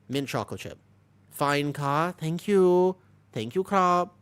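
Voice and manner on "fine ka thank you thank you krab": higher, louder polite thank-you with Thai particles; respectful Thai politeness. Real, not acted. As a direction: thank them respectfully, pitched up. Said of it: "she went all respectful in Thai".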